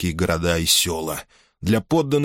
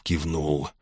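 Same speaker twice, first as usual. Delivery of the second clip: plain reading voice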